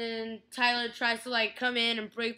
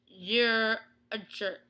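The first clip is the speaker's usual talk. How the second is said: deliberate scolding; 2 semitones lower, much slower than usual. Retold or imitated. Retelling her scolding reaction to Stefan.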